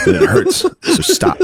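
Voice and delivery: very high, comic near-crying voice; mock tearful plea. Teased kid begging them to stop, mock-tearful squeak. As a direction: plead as if about to bawl, laughing underneath. Acts sensitive kid about to cry.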